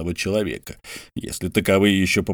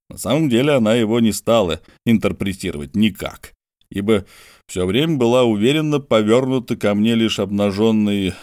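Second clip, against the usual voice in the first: usual speaking voice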